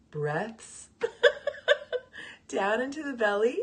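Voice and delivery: breaking into a real laugh mid-instruction. Real, not acted. Meditation teacher tripping over the word breaths, laughing. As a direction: stumble on the word and crack up, carrying on through giggles. Cracks up while saying breaths.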